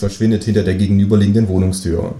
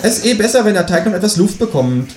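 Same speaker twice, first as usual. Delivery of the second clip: high-pitched kangaroo neighbour; kangaroo character; 11 semitones higher than usual. Acted. Kangaroo asks the new neighbour for eggs, high-voiced. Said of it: "sagt das Känguru".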